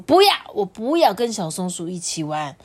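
a touch of pleading; stubborn little owl insisting; insistent child. Acted. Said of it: "他就说".

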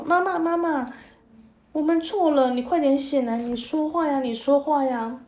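put-on loud pleading child. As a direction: beg your mother to wake up. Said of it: "小儿子也大声地说".

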